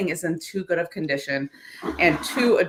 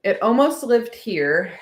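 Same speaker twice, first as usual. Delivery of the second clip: ordinary talk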